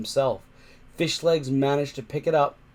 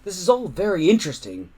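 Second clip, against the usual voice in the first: choked out; 6 semitones higher, 4 dB louder, faster than usual. Acted. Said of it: "Hiccup managed to choke out".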